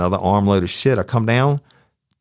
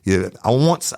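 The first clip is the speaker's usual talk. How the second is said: defiant challenge; 6 semitones higher than usual. Retold or imitated. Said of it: "Challenging without challenge".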